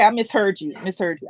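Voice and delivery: usual speaking voice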